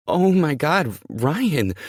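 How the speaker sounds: high, loud startled scream; frightened high-pitched yell; screaming in fright. Acted. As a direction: shriek it out in shock. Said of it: "then she's screaming".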